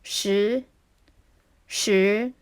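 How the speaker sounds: slow rising-tone "시" demo; Mandarin rising tone. Shown as a demonstration. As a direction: say "시" and let the pitch go up. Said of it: "Going up".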